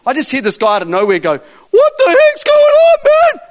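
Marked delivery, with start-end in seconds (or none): 1.3-3.4 very high, loud, drawn-out holler; mimicked panicked yell. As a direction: holler like a freaked-out bystander. Mimics startled man yelling out.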